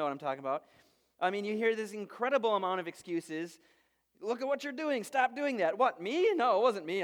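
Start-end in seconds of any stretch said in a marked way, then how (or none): 4.2-5.8 higher-pitched mock scolding; exasperated parent telling off a child. 5.8-7.0 high, louder mock-innocent protest; indignant little denial, overdone; feigned innocence. Child feigns innocence when caught.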